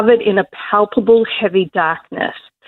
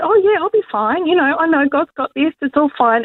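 breezy mock confidence; 6 semitones higher than usual. Mocking own carefree self-talk.

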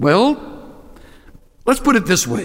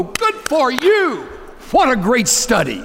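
slow, higher mock approval; sarcastic praise; 8 semitones higher than usual. Sophisticates faking praise for a study of the cross, slow and high. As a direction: pretend to be impressed. Imagined group reaction, sarcastic.

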